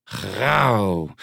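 drawn-out play-acted lion growl; pretend growl. A narrator's reading. Mie plays the ant lion, pretend growl. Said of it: "gromde ze".

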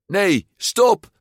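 high, loud cry to stop; alarmed warning. A narrator's reading. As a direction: cry out urgently to hold him back. Friend urgently warns him off. (geschrokken uitroep)

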